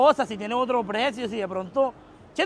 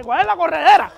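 raised, higher-pitched taunting; loud quarrelsome questioning; heated challenge; 4 semitones higher, 7 dB louder than usual. Real, not acted. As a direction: loudly challenge them, pitch climbing.

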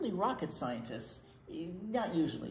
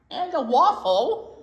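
playful surprise; 5 semitones higher, 11 dB louder than usual. Acted. Silly reveal of octopus holding waffle.

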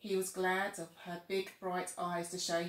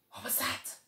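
unvoiced frightened whisper. A narrator's reading. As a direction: breathe it out, scared. Scary moment in dark jungle.

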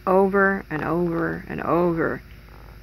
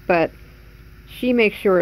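speaking past a held denture; denture held to lips. Real, not acted. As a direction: object against the mouth. Seen 3: Upper denture plate pressed against her lips, then moved away by 2.0.

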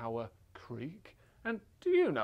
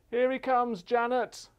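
raised, higher calling voice; 8 semitones higher, 5 dB louder than usual. Real, not acted. Presenter passes Little Bear to Janet, calling out. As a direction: call ahead to another boat.

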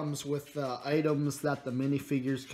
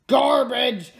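sudden loud, higher outburst; loud dismissal; 9 semitones higher, 8 dB louder than usual. Real, not acted. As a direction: blurt it out in mock disgust. Outburst about truck piece.

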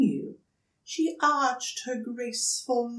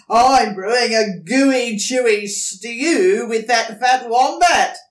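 loud, grinning dingo boast; toothy grin; 4 semitones higher, 12 dB louder than usual. Acted. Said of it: "with a toothy grin".

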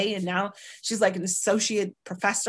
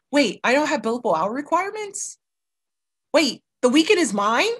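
rising higher and louder, amazed at free time; like a lawyer who can't believe the weekend is hers; incredulous disbelief; 5 semitones higher, 3 dB louder than usual. Retold or imitated. Ex-lawyer reliving her shock at having free time, incredulous.

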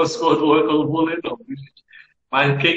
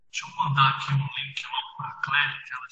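whispered aside; 4 semitones lower, 8 dB quieter, faster than usual. Real, not acted. Aside while sending a link.

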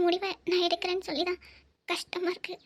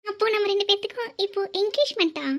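excited surprise; 5 dB louder than usual. Acted. Surprised by the engagement setup.

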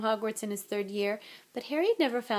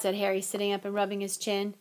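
ordinary delivery

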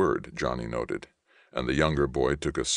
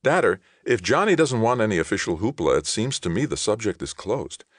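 higher, louder interjection; speaking up; 7 semitones higher, 4 dB louder than usual. A narrator's reading. Younger brother defending Johnny from the corner, speaking up. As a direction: cut in firmly, pitched up. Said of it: "spoke up unexpectedly".

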